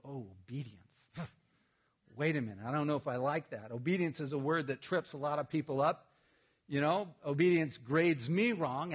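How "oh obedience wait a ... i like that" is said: a hint of a grudging listener, low; lightly voiced wary congregant; reluctant churchgoer. Pastor mimics a reluctant listener balking at obedience. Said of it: "I know for some of you go".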